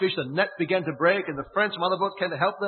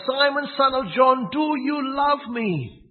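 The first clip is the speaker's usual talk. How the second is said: slow pleading call; 9 semitones higher, 7 dB louder, slower than usual. Acted. A third time.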